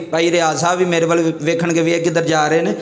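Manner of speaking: ordinary delivery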